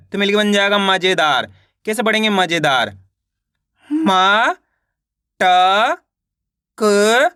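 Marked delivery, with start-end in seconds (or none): 3.9-7.3 "मा, ट, क" separately, each stretched and lifted at the end; slow letter-by-letter "मा, ट, क"; sounding out letters. Shown as a demonstration. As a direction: sound out "मा", "ट", "क" with pauses between. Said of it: "एक एक अक्षर का उच्चारण करके".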